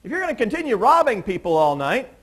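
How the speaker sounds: high, loud call down the street; yelling after him. Retold or imitated. Julio shouting after the kid who robbed him, loud and high. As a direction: shout it after someone running away. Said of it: "And so he yells after him".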